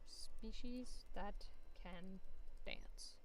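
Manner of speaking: slow, soft whisper. Real, not acted. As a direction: mutter it to yourself while searching. Murmurs while searching.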